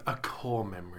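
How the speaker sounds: hushed whisper. Real, not acted. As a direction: breathe it out as a secret. Joking hushed aside.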